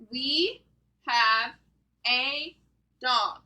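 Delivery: "We", "have", "a", "dog", each standing alone; separate, evenly spaced words for early readers; word-by-word reading. Shown as a demonstration. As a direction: read "We have a dog" with a pause after every word. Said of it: "let's read these sentences".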